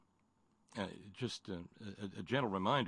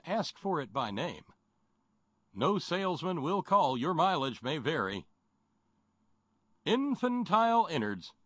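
chipper 1950s ad; 3 semitones higher than usual. Acted. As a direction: rattle off the ad tagline. Mock ad disclaimers.

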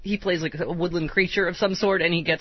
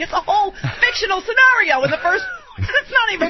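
exasperated high rant; 12 semitones higher, 5 dB louder than usual. Retold or imitated. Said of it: "Jim's like".

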